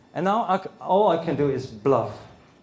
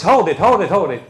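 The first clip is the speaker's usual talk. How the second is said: loud hold it, hold it; halting them loudly; panicked ex; 7 dB louder, faster than usual. Retold or imitated. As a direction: ex crying out to stop. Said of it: "she says".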